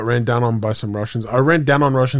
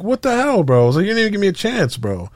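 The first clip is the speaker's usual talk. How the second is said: outraged disbelief; 9 semitones higher, faster than usual. Retold or imitated. Just got killed without warning.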